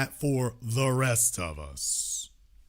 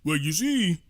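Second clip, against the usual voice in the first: mocking Steve Harvey; 3 semitones higher than usual. Host imitating Steve Harvey excusing his Trump visit, mocking. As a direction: whine it like a sellout justifying himself. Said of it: "When Steve Harvey goes back down there".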